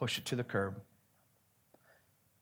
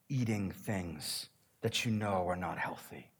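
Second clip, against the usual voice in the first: whisper; 4 semitones lower, 6 dB quieter than usual. Acted. Pointed aside to congregation.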